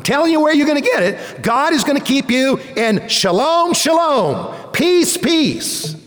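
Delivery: high, fast, fervent sermon climax; preaching at full force. Acted. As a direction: lift the room with conviction.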